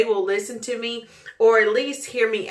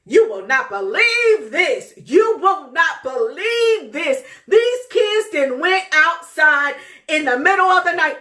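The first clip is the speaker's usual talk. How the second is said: outraged rant; 6 semitones higher, 5 dB louder than usual. Retold or imitated. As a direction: vent to your mother, fuming. Mom ranting to grandma.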